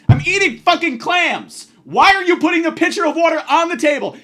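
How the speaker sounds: loud, high, furious rant; angry rant. Retold or imitated. Comic ranting at the waitress about the water pitcher, loud and high. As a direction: shout it at the waitress, outraged.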